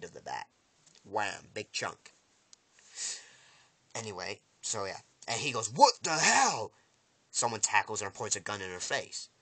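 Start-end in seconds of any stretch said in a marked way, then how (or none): 5.7-6.7 loud, high, drawn-out disbelief; outraged shout. Retold or imitated. Villain reacts to his bat being shot, outraged shout. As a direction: stretch it out in mock outrage.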